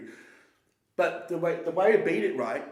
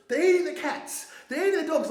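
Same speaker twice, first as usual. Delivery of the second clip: mock-quote a debate line, pitched way up; Trump; 13 semitones higher, 3 dB louder than usual. Retelling the debate to a mate, high-pitched Trump imitation. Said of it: "He goes".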